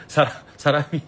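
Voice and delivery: ordinary talk, nothing marked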